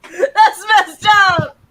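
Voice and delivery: loud laugh breaking words; laughing hard. Real, not acted. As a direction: crack up while talking.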